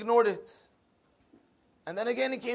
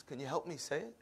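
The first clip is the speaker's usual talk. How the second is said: soft, low plea for help; hushed request; 4 semitones lower, 11 dB quieter than usual. Retold or imitated. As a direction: ask it very quietly and low. Mourner shyly asks rabbi for help.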